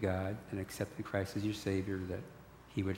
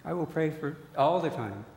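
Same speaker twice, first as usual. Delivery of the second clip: higher, louder broken-English vow; accented father; 7 semitones higher, 6 dB louder than usual. Retold or imitated. Father promising his son he will always pray, accented. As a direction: imitate dad promising eagerly.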